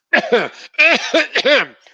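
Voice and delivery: loud forced cough burst; demo cough. Shown as a demonstration. Lecturer showing a cough tic to the audience, loud cough. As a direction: cough hard like a vocal tic.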